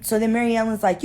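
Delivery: ordinary talk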